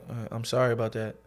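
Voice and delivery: low, soft retold apology; quiet apology. Customer backing off after the clerk refuses, quiet apology. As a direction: imitate a subdued, awkward sorry. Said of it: "So it gets quiet".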